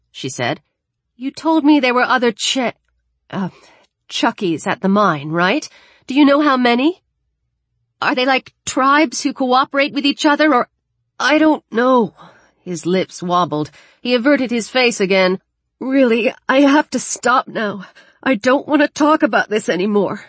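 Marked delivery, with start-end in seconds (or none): 1.2-10.7 higher-pitched voice, pressing for answers; urgent questioning girl. Acted. Alex presses injured Daniel about the others, urgent questioning. 11.2-12.1 high-pitched upset reply, louder; distressed boy. Acted. Injured Daniel answers Alex's questions, distressed. 15.8-20.2 higher-pitched, upset plea; distressed pleading. Acted. Injured Daniel wants Alex to stop asking, distressed pleading.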